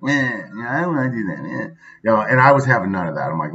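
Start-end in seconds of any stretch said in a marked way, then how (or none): 0.1-1.7 a hint of a whiny, spoiled four-year-old; whiny spoiled kid. Dad imitating his spoiled son, lightly whiny. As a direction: lightly mimic a kid refusing to do anything. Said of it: "being spoiled methods".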